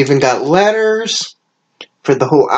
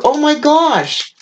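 gushing delight; 11 semitones higher than usual. Real, not acted. Overwhelmed by gifts.

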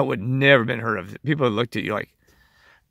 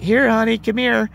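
put-on much higher sugary baby talk to a dog; mocking baby talk; 11 semitones higher, 4 dB louder than usual. Mocking dog owners who coo at pets, higher cooing baby talk.

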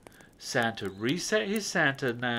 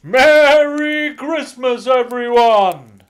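loud, much higher, slow greeting; slow loud festive Santa greeting; jolly Santa call; 11 semitones higher, 16 dB louder, slower than usual. A narrator's reading. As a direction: call out like Father Christmas.

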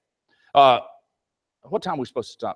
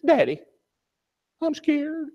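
put-on high small girl's voice, much higher; little girl; 11 semitones higher, slower than usual. Little daughter tells her father in the dark, high and scared. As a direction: play a scared three-year-old. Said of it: "and she says".

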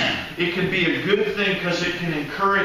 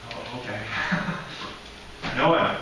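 sheepish realisation; 7 semitones lower, 6 dB quieter, faster than usual. Retold or imitated.

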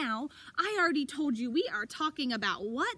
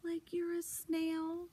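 slow like a snail; 8 dB quieter, slower than usual. Shown as a demonstration. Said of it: "move slow like a snail".

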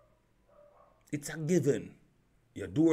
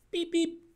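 car lock beep. Retold or imitated. Imitating car lock sound.

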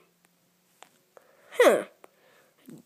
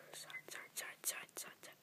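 soft, unvoiced chant of each move; whispered counting; 29 dB quieter than usual. Real, not acted. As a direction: whisper the moves to yourself. Concentrating on the game.

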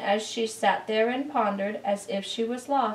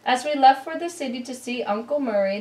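usual speaking voice

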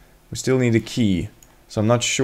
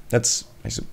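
ordinary talk

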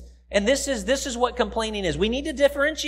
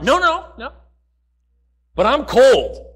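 louder whiny complainer; 6 dB louder than usual. Retold or imitated. Complainer refusing help again.

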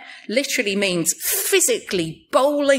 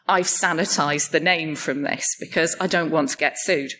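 usual speaking voice

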